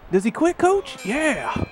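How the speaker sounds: high, loud triumphant shout; excited yelling. Real, not acted. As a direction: celebrate the win out loud.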